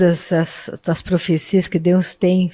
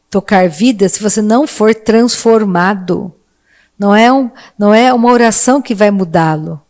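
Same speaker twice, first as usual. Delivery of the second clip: urgent, rising preacher's voice; fiery preaching; 3 semitones higher, 3 dB louder than usual. Acted. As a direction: push the sermon with growing force. Preaching builds to full force. (pregação inflamada)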